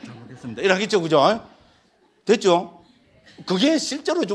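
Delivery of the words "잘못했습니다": low, very soft submission; meek apology. Acted. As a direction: shrink into a hushed apology. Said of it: "이러면 뭡니까?".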